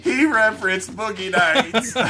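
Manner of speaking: high, loud, slowly stretched scandalized voice; mock tattling. Host telling on co-host over Boogie Nights, mock outrage. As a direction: tell on him in pretend horror, drawn out.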